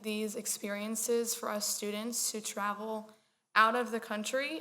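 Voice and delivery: normal talking voice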